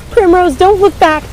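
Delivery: high, loud desperate yell; frantic shout. Acted. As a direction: scream at her to keep running. Fleeing the vortex.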